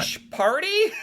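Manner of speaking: high, disbelieving bush party; delighted surprise, pitched high; surprised. Real, not acted. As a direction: ask it in amused disbelief. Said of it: "I've never heard of that".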